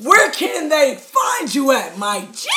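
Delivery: cracking, overexcited voice. Real, not acted. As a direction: voice breaking from excitement. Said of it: "my voice is, like, cracking".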